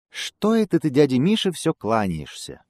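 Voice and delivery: a lightly voiced squirrel calling down. A narrator's reading. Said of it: "Белка ему с дерева кричит". (белка кричит с дерева высоким голосом)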